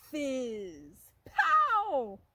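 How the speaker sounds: high, loud burst on pow; explosive pow. Pretend. Reading the rocket test to a child, loud pow.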